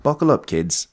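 lilting put-on Scottish; Scottish accent. Rick offers to talk like this all show, Scottish accent. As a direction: keep up a Scottish accent in chat. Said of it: "Maybe I'll talk like this".

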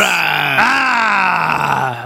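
gruff gravelly shout; gravelly grunt. Retold or imitated. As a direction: bark a gravelly ah. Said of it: "the most gravelly voices".

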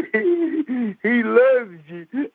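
high, slow, laughing through it. Retold or imitated. Brother telling his sister the boy loves her, laughing. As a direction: tell her through a giggle.

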